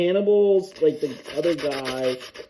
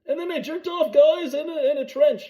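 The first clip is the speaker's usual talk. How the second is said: high silly voice; 11 semitones higher than usual. Pretend. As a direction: squeak it high like a cartoon weirdo.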